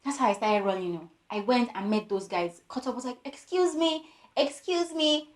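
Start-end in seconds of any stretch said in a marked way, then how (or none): 3.2-5.3 high, small pleading call; tiny voice. Retold or imitated. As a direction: imitate your own tiny voice calling for attention. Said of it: "Me and my tiny voice".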